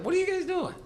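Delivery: high, loud disbelieving cry; a high, loud "what are you doing" at the brawl; incredulous call-out. Retold or imitated. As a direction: shout it like you can't believe your eyes. Mocking the brawling fighters.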